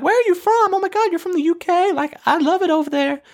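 very high, gushing diva impression; hammed-up high celebrity voice; Tiffany Pollard. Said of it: "worst impression of Tiffany Pollard".

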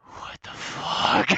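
slow, breathless whispered disbelief; stunned whisper. Retold or imitated. Small boy back in stroller, whispering slow shock at mom.

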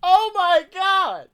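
high, loud amazed yelling; real excited outburst. Real, not acted. Host stunned it was just right off the dome, loud excited yelling. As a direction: holler in disbelief at your own joke. Thrilled at own improvised joke.

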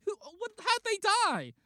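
high, loud flustered demand; mock outraged detective; exasperated questioning.

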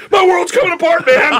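high, loud mock wail of despair; anguished cry. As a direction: cry out like your world is ending. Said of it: "just going".

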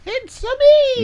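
high, drawn-out Mario catchphrase; Mario impression. Teasing cohost about the Mario film, high Mario voice. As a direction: squeal it slowly like Mario. Mimics Mario after trailer mention.

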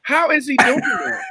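high, slow amazed exclamation; astonished. Real, not acted. Amazed at puppet trick.

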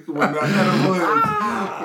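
slow, laughing, drawn-out; stoned. Pretend. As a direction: draw it out like you're high. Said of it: "edible joke repeated, laughing".

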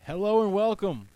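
slow, high, loud announcer welcome; soothing host voice. Acted. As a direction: roll it out slowly like a smooth host. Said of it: "Oh, you're calming me. You're soothing me".

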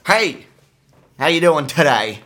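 high, loud mock greeting; old guy yelling. Imitating a weird old guy yelling to the cashier. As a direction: bellow a cheery hello across the store. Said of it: "he just yells to the cashier".

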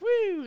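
high, drawn-out little woo; light thrill-ride woo; playful whoop. Retold or imitated. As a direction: whoop like you're bouncing in your seat. Re-enacts bouncing in the seat.

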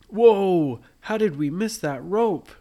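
put-on children suddenly spotting a trap rope, startled; loud surprised whoa. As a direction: blurt it out in alarm. Kids spot the hidden rope.

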